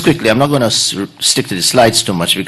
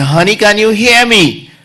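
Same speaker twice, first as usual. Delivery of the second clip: higher call from five feet; 9 semitones higher, 3 dB louder than usual. Retold or imitated. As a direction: ask loudly if she hears. Husband calling from five feet.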